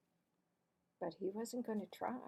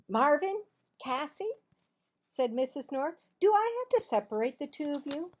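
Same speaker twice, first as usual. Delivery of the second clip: high, loud stern teacher call; scolding teacher; 6 semitones higher, 9 dB louder than usual. Acted. Mrs. North to the class, high scolding call. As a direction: snap at the chatty pair.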